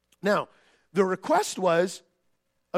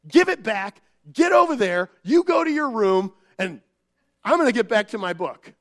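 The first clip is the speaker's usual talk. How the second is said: impatient parent; 3 semitones higher, 6 dB louder than usual. Retold or imitated. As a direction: bark them off to their room. Parent snapping at kids.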